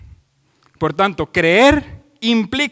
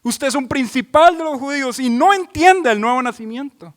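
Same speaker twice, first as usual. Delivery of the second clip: firm rebuke; 9 semitones higher, 6 dB louder than usual. Retold or imitated. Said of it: "Jesús doblega ese orgullo religioso y le dice".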